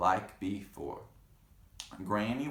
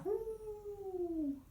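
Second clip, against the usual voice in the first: high soft yowl; high cat howl; 6 dB quieter than usual. Acted. Cat howling in story.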